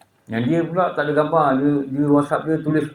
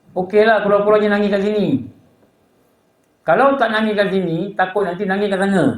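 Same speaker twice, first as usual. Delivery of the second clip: raised, higher-pitched sermon delivery; preaching warning; 5 semitones higher, 3 dB louder than usual. Acted. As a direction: warn the congregation with a lifted preaching voice.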